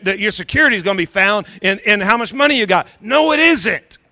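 normal talking voice

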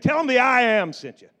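loud, booming command; commanding God voice. Acted. Pastor voicing God's answer to Moses, booming and commanding. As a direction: proclaim it with authority.

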